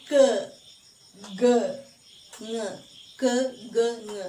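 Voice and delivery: "k, g, ng" as separate "kuh, guh, nguh"; teacher sounding out "k, g, ng" as syllables, not letter names; isolated velar sounds. Shown as a demonstration. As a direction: model "k, g, ng" one by one with pauses. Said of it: "Try to say the sound".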